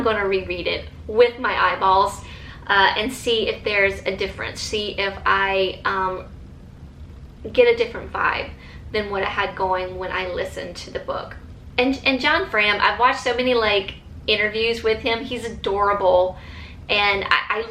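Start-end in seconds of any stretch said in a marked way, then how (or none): none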